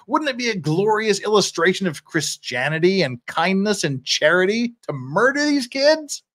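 high, ringing mock celebration; sarcastic oratory. Defender scorning a glorious day for Chicago, biting sarcasm. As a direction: pile up rhetorical questions, rising at the close. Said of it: "That's sarcasm".

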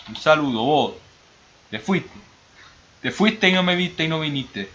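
hammed-up Argentine accent, high-pitched. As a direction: greet and reproach a friend in Argentine accent. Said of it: "Me encanta ese acento".

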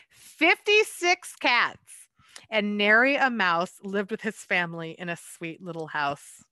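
lively picture-book read-aloud; story narration. A narrator's reading. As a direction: read it like a bedtime rhyme to children.

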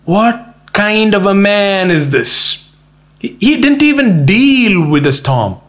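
high, drawn-out amazement; acted bewildered wonder, pitched high; astonished disbelief. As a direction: marvel at it incredulously. Acts out disciples' astonishment.

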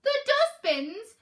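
high, loud, drawn-out incredulity; disbelieving. A narrator's reading. Peaches hearing where she must sleep, disbelieving. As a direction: echo it back, aghast. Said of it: "couldn't believe what she was hearing".

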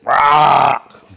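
loud, drawn-out crow caw; crow squawk. Retold or imitated. Female crow nagging her mate, loud squawk. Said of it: "she's just going".

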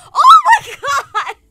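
loud, high, drawn-out squeal; delighted shriek. Real, not acted. Overjoyed at the happy character.

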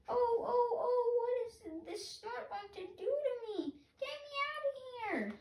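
very high, panicky chick voice; a chick lifted into the air, panicking; baby bird, frightened. Acted. Baby bird carried up by the snort, high and scared. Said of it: "my baby bird voice".

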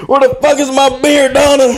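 high, hammed-up Boston husband; Boston accent. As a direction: holler for a drink in mock Boston style. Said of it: "Boston has a different accent".

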